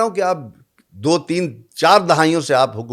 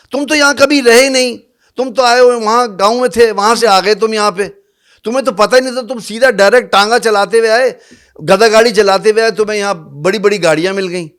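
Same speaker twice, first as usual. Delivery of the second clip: raised, louder voice scolding the rulers; angry outburst; 6 semitones higher, 7 dB louder than usual. Real, not acted. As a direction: hit them with it, loud and bitter. ناراض ہو کر حکمرانوں سے براہ راست خطاب. (حکمرانوں کو براہ راست غصے سے ڈانٹتے ہوئے، بلند اور تیز آواز)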